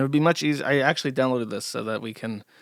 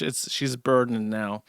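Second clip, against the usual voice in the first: ordinary delivery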